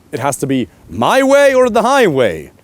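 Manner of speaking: high, loud, overblown ultimatum; mock bullheaded demand. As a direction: bellow it like a stubborn partner. Mock stubborn man refusing to compromise.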